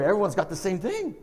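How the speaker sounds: ordinary delivery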